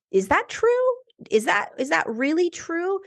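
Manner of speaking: high-pitched, incredulous self-questioning; suddenly catching yourself, voiced high; questioning disbelief. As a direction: lift it high, doubting your own thought. Acts out sceptical self-questioning.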